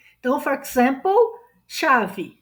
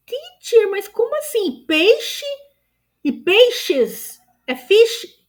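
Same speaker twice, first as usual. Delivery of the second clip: higher, louder puzzled student protest; baffled student; 10 semitones higher, 5 dB louder, slower than usual. Pretend. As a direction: play the confused pupil asking the teacher. Teacher voices a baffled student.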